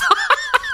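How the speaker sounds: high, sudden laugh; burst of laughter. Real, not acted. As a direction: laugh at your own slip. Laughing at own slip.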